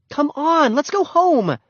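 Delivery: high, impatient big sister. A narrator's reading. Beezus urging the crying Ramona home, impatient. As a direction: hurry her along. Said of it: "said Beezus impatiently".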